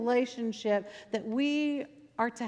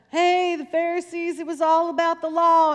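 higher, louder rhythmic preaching call; riled-up preacher; 6 semitones higher, 7 dB louder than usual. Retold or imitated. Preacher getting everybody all riled up, loud rhythmic call. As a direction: imitate a preacher firing up his church. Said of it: "he would get into this kind of rhythm".

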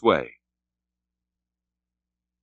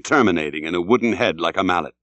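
ordinary delivery, nothing marked

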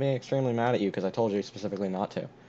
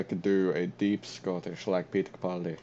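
slightly lower, faked Scottish accent; Peter Capaldi; 3 semitones lower than usual. Shown as a demonstration. Offering friend an accent choice, deep Scottish. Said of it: "Don't do the deep Scottish".

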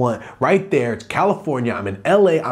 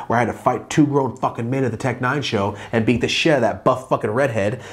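normal talking voice

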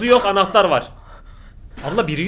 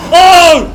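startled scream; 11 semitones higher, 16 dB louder than usual. Real, not acted. Jump scare on screen.

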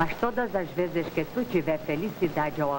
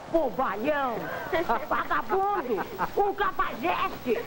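angry; 7 semitones higher than usual. Acted. Furious insults at boastful man.

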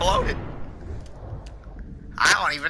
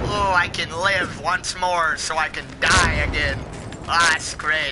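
ordinary delivery

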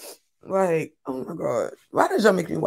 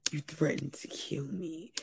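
much softer, slow mocking whisper; 4 semitones lower, 17 dB quieter than usual. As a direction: whisper it like a cheap horror line. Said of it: "mocking Leah's whispered line".

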